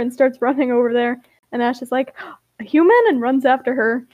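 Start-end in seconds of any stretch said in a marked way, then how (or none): 2.6-3.0 higher, louder startled question; astonished. A narrator's reading. Ash sees a human for the first time, astonished. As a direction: ask it in amazed disbelief.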